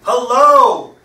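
loud, high-pitched parrot. A narrator's reading. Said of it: "says the parrot".

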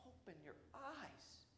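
soft, slow whisper; whispered plea. Acted. Jesus whispering to James and John who beg for glory. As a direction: breathe it out slowly, pleading. Jesus answering begging disciples.

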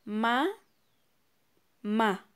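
"Ma, ma." split into two beats, a pause between; counting syllables aloud for a child. Shown as a demonstration. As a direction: clap out "ma" and "ma" separately. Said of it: "¿Cuántas sílabas tiene?".

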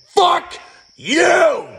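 a raw, high, loud yell of rage; furious shout. Real, not acted. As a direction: scream it out in real anger. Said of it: "I just had to come out here and fucking yell".

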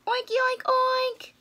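high oinking pig voice; pig talk. Acted. Gerald imagines the pigs, high oinks. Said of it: "He's thinking".

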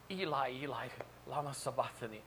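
soft, hushed Aramaic plea; subdued cry from the cross. Acted. Preacher voicing Jesus on the cross, subdued cry. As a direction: let the cry out softly and solemnly. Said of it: "Jesus cried out".

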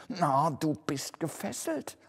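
high, frightened voice; trembling murmur. A narrator's reading. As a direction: say it in trembling awe. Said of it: "murmelte Scrooge zitternd". (zitternd, mit hoher ängstlicher Stimme)

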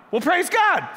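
high, loud mock cheer; mock praise. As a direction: throw up a fake hallelujah. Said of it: "Would you be like".

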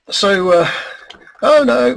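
usual speaking voice

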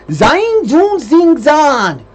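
high, very loud mock German; hammed-up German accent, loud and high; crappy German accent. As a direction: shout drawn-out fake German in a high voice. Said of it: "German accent".

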